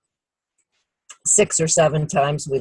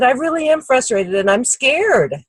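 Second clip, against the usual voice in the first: retold frustration; 7 semitones higher than usual. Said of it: "You sound so frustrated".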